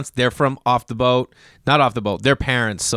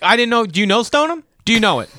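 raised, high-pitched comeback; heated mock retort; 10 semitones higher, 5 dB louder, faster than usual. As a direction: challenge them loudly, hammed-up. Acting out heated retort about Stoneham.

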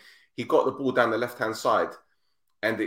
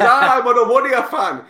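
excited fan; 10 semitones higher, 10 dB louder than usual. Retold or imitated. Passport officer voiced in story.

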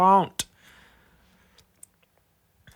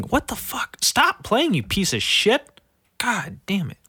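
annoyed outburst; 8 semitones higher than usual. Real, not acted. Autoplay video starts.

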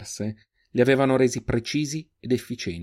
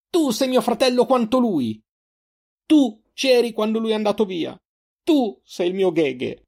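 high, anguished outburst; desperate anger; 12 semitones higher, 4 dB louder than usual. A narrator's reading. Sister claiming him as brother, desperate and angry. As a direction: cry out high, despair mixed with rage. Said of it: "La disperazione e la rabbia nella sua voce".

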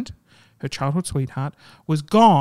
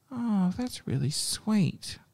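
tender; 3 semitones higher, 7 dB quieter, slower than usual. Real, not acted. Touched by the story.

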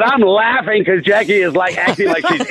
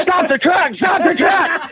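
mock panicked yell, pitched higher; "stop the truck" yelled higher in mock panic; imitating her scream; 9 semitones higher, slower than usual. Caller imitating the panicking host on the phone, mock high yell. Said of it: "She's screaming".

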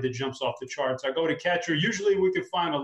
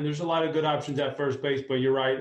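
normal talking voice

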